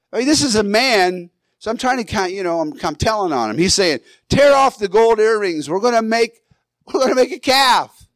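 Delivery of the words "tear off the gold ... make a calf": higher-pitched, hammed-up order to a crowd; mock command, pitched well up; acted-out Aaron. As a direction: play at rallying the people in a raised voice. Acting out Aaron ordering the people.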